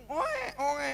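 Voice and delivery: high mock wail of a crying baby; imitated baby crying. Preacher mimics the baby crying in the bedroom, high wail. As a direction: cry out like an infant left alone. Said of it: "Nangis kenceng".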